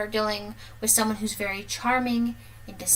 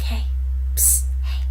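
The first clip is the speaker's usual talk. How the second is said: hissed "psst" to get her attention; sneaky whisper; 4 dB louder than usual. Retold or imitated. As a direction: whisper through the boards like a peeping stranger. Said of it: "and he's like".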